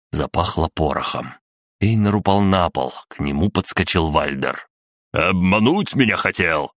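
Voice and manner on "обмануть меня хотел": moderately raised accusing cry; lightly acted outraged yell; angry shout. A narrator's reading. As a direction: accuse the man who tricked you. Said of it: "крикнул он".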